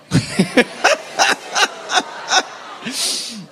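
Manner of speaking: breathless laugh. Real, not acted. Laughing after congregation repeats.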